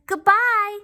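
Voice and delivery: high, loud farewell call; shouted goodbye. Acted. Dump truck calling goodbye to the toys, loud. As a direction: call it out as you leave. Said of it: "shouted the doll".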